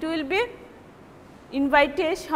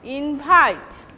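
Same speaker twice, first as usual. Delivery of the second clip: "invite" with the main stress on the final syllable; stress on second syllable. Shown as a demonstration. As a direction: keep the first syllable secondary, lean on the end of "invite". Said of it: "the second syllable will take primary stress".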